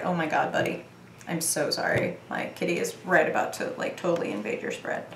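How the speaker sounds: hushed, fond words to the kitty; quiet aside to cat. Real, not acted. Reader talking softly to her cat. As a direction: murmur down to the cat.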